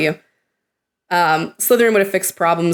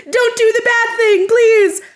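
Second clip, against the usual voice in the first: much higher, near-crying plea; panicked plea; 4 dB louder, slower than usual. Retold or imitated. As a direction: beg through mock tears. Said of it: "just that panic".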